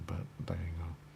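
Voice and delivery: breathy whispered aside; a whispered afterthought. Real, not acted. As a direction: drop to a whisper as you shrug it off.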